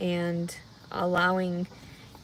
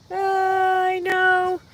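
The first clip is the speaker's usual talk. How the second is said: whine; 10 semitones higher, 7 dB louder, much slower than usual. Real, not acted. Mock distress drawn out.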